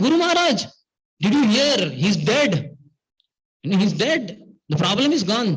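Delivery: high-pitched, eager imitation of puzzled disciples; wondering excitement. Disciples asking if Guru Maharaj heard, incredulously excited. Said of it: "And they were wondering".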